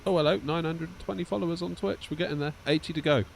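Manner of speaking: excited. Real, not acted. Greeting new Twitch followers.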